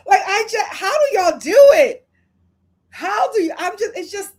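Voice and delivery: loud, high, laughing exclamation; amused disbelief. Real, not acted. Host baffled by British accents, loud laughing disbelief. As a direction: cry it out through real laughter.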